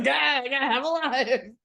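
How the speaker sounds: high laughing mock-weak voice; mock feeble. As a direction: play sick, cracking up with laughter. Mocking Big's pretend weakness.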